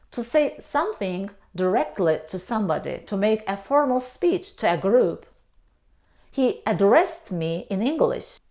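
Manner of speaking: usual speaking voice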